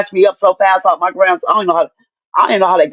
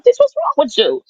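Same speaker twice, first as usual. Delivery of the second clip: retold self-scolding, pitched well up; talking to oneself; 8 semitones higher than usual. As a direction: imitate questioning yourself in a high, baffled voice. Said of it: "I could hear myself talking like".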